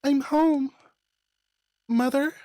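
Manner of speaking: coming home late, calling loudly for her mother. Acted. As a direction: call out to find her mother.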